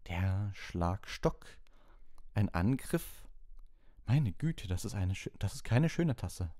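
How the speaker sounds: high, drawn-out misty prophetess; mystic seer voice. A narrator's reading. Trelawney to Harry, reading his cup in a high mystic voice. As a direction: peer into the cup like a dramatic fortune teller. Trelawney reads tea leaves.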